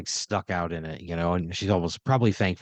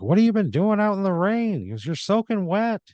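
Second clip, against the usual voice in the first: high, loud teasing voice; Michael voice; 11 semitones higher, 3 dB louder than usual. Acted. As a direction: pitch way up and rib her for getting soaked.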